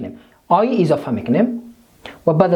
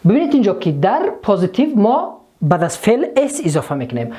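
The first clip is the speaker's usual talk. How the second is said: ordinary talk, nothing marked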